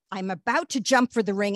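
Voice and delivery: ordinary delivery, nothing marked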